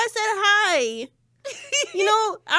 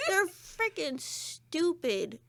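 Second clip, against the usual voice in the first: slow laughing emphasis; much slower than usual. Real, not acted.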